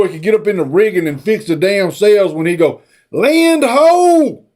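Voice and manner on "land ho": raised mock call of land sighted, pitched up. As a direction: shout it out across the deck.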